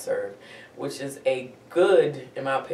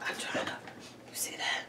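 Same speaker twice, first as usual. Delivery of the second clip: whispering; 9 dB quieter than usual. Real, not acted. Hushed while viewing the photo.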